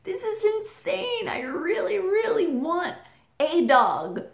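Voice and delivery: put-on high teary kid pleading with dad; distraught kid. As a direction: wail at dad like a crushed four-year-old. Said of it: "And I'm like".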